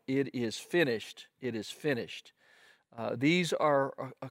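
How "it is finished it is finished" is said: ordinary talk, nothing marked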